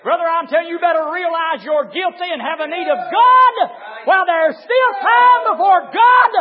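loud, high-pitched hellfire sermon; thundering pulpit shout; full-force preaching. Acted. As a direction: preach it at the top of your voice.

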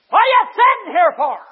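sudden high, thunderous shout; booming-loud, high-pitched scolding from above; Holy Ghost. Retold or imitated. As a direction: blast the question out as a divine rebuke. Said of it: "the Holy Ghost of God said".

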